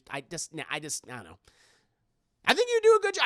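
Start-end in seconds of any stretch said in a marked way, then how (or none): 2.5-3.2 high, loud and bright praise; high excited praise. Acted. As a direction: cheer them on. Mock encouraging reply to chat.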